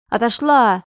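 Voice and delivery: громкий резкий крик; отгони их криком; крикнув. A narrator's reading. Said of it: "крикнула Аня". (Аня кричит наступающей Петровой)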